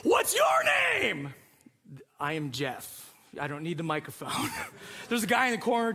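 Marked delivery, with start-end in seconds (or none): 0.0-1.3 very high, drawn-out host question; hammed-up emcee shout; microphone host. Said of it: "she's got her microphone". 2.2-4.5 low, soft deadpan; mock underwhelmed mumble; deadpan reply. Underwhelmed answer to perky host.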